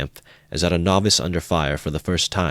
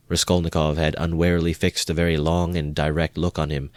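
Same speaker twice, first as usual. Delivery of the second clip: usual speaking voice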